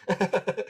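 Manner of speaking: high, giggly chuckle; short run of giggles; amused laugh. Real, not acted. Laughing at the stepbrother's story.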